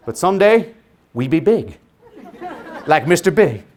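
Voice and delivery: lightly imitated preacher cadence, broken by pauses; Martin Luther King Junior. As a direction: wind down the mock sermon phrase by phrase. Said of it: "[name] spoke like Martin Luther King Junior".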